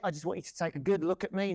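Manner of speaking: ordinary delivery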